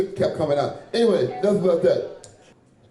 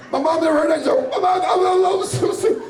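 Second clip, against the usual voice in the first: high-pitched, laughing too hard to finish; cracking up; 11 semitones higher than usual. Real, not acted. Comic to crowd about mom hearing the joke, cracking up. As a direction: stammer through helpless laughter, voice shooting up.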